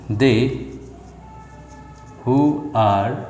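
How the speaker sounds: broken into small chunks, long pauses between; dyktowanie przy pisaniu. Shown as a demonstration. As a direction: dictate slowly while writing it down. Writing out an example sentence.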